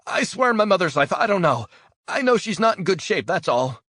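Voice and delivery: very high, very loud desperate denial; terrified screaming plea. Acted. Prisoner swears he knows nothing as rope is threatened, panicked screaming.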